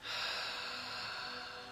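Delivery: long, soft voiceless breath out; slow releasing sigh; audible exhale. Shown as a demonstration. Demonstrates audible exhale in meditation.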